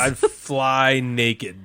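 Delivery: very slow, each word stretched; slow drawn-out. Real, not acted. To friends, repeating the naked-flying pick slowly and drawn-out. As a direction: drag it out, savoring the choice. Repeats own choice, slower.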